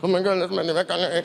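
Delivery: mild starstruck babble under his breath; freaking out. Retold or imitated. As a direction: rush it out like a panicking fan. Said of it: "I start freaking out".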